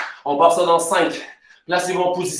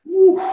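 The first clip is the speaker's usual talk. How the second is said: cri d'effort aigu; 12 semitones higher, 4 dB louder than usual. Real, not acted. Coach exhales during workout.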